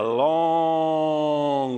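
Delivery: "long" stretched out very slowly; drawn-out LONG. Real, not acted. Preacher describing the trek to the prayer room, stretched "long". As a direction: hold "long" to act out the distance. Stretches word to show the distance.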